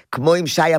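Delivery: usual speaking voice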